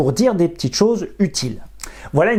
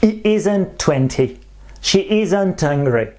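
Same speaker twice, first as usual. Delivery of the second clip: slow careful demo. Shown as a demonstration. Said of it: "remplace le O par l'apostrophe".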